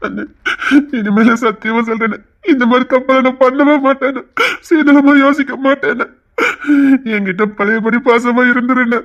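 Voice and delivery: tearful, pleading vow; crying like a child. Acted. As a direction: swear to change through sobs. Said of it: "குழந்தை மாதிரி அழுதுகிட்டு இருக்கான்".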